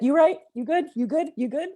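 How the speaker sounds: high, loud rapid questions, each rising; acting out girlfriends checking on each other; urgent friend check-in. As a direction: toss out quick, insistent check-ins.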